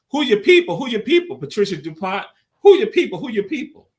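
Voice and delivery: high, loud repeated call-out; hype call. Acted. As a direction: shout it like a crowd chant. Host hyping the signature question.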